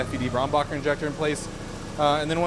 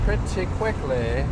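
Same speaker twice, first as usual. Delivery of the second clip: Moira Rose voice; slower than usual. Shown as a demonstration. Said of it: "Sounded like Moira Rose right there".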